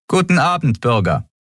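curt officer. A narrator's reading. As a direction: keep it short and official. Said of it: "sagte sie knapp".

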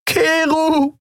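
very high, desperate wail; weeping scream. A narrator's reading. Andriel cries after the departing ship, screaming. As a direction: scream the name through tears. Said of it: "Sie weinte, hustete und schrie".

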